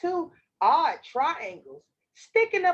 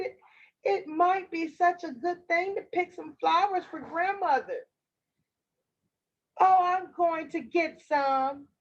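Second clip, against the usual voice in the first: put-on higher-pitched little girl; chatty child, pitched up; 5 semitones higher than usual. Girl explains her errand to the wolf, higher child voice.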